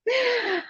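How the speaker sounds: high squeal of laughter. Real, not acted. Laughing at her kids' news.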